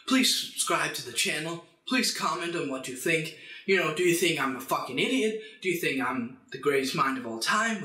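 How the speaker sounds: normal talking voice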